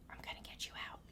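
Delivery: a soft unvoiced whisper; secret whisper. Grandson secretly promising the trapped mermaid, whispered. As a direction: breathe the promise so nobody hears.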